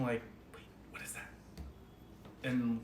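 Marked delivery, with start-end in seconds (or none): 0.5-1.3 hushed unvoiced whisper. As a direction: whisper it nervously. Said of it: "just hear it whispering".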